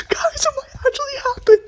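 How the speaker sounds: very high, soft, strained squeal; excited squeal. Real, not acted. Fan telling viewers Nagi might return, high squeal. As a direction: squeak it out, barely containing hype.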